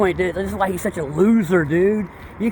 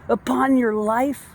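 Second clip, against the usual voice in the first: normal talking voice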